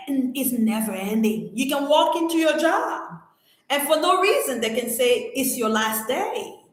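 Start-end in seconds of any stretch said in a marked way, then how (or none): none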